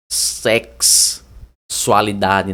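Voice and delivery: "Sexualidade" slowly split, SEKS... sualidade; pronunciation demo. Shown as a demonstration. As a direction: stretch the K-S in "Sexualidade", then pause. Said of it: "desse jeito que se pronuncia".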